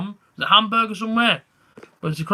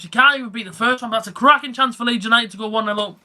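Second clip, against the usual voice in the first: raised, lively match commentary; excited play-by-play; 4 semitones higher than usual. Acted. Fan streamer calling Leeds' chance to his viewers, excited play-by-play. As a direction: call the near miss like a live commentator.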